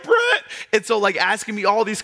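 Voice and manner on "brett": put-on very high, louder crying child voice; bawling little boy. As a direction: wail it like a sobbing five-year-old. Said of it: "I was like".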